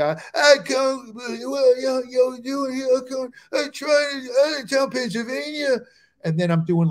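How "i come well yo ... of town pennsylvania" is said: high, slurred rock-singer impression. Comic doing silly voices at a bored crowd, high slurred rocker. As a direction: garble it high and drawn out. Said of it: "I'm doing [name]".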